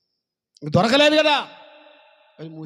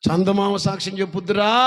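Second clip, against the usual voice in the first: recalled accusation; 3 semitones higher, 8 dB louder than usual. Retold or imitated. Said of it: "ఏమన్నాడు చచ్చిపోయేటప్పుడు".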